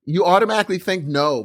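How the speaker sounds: ordinary talk, nothing marked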